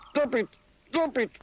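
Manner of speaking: high, loud cry. A narrator's reading. The elephant cries out as his trunk is knotted. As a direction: yelp it out in pain. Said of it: "cried the elephant".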